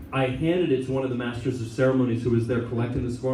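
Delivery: normal talking voice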